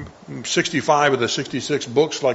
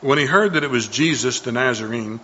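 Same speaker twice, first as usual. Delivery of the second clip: usual speaking voice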